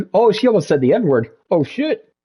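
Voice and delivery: ordinary talk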